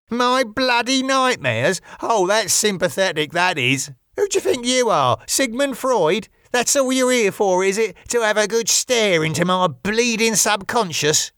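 high, affronted sarcastic voice; indignant rant. Acted. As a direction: bristle with wounded sarcasm, pitched well up. Wayne's indignant outburst at Jimmy.

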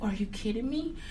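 unvoiced "are you kidding me"; whispered disbelief. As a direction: breathe it out in disbelief. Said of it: "I was just like".

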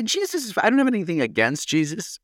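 squeaky high mocking repeat. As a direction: parrot it back in a high teasing voice. Repeating listener's odd sentence mockingly.

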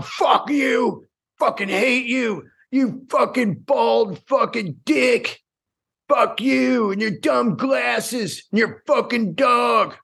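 mock furious shout. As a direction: rant like an enraged online hater. Imitated hater screaming insults.

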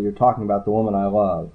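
ordinary delivery, nothing marked

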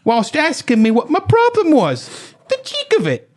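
high, slow mock British outrage; milk it in a squeaky-high British accent. Garden owner scandalised by the driver's cheek, hammed-up British. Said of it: "She said, this is real".

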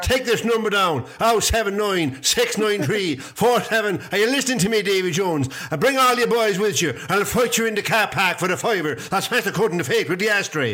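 high, fast, hammed-up pub challenge; a mock drunk picking a car park fight, high and fast; mock pub hard man. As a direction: rattle off a boozy fight challenge at speed.

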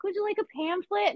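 high, fast, chirpy helper voice; friendly staff voice. Retold or imitated. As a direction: play the eager attendant offering pamphlets. Imitating friendly rest area staff.

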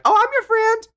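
high, squeaky mock-sweet friend voice; mock Heather. Imitating Heather's fake sweetness to Jen. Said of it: "see Heather be like".

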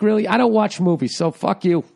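ordinary talk

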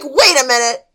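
loud sudden realization; surprised. Retold or imitated. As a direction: imitate the penny dropping. Imitating realization.

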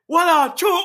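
high, stretched Jamaican "What up, Chop?". Retold or imitated. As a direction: repeat his greeting, leaning on the accent. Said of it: "But the way he said".

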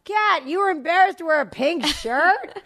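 high calling voice, mock yell across a yard; child yelling to her big sister across the school quad, lightly mimicked; imitating a yell across the quad. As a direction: call out to a sister far away, imitating a child. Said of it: "yells to Kat across the quad".

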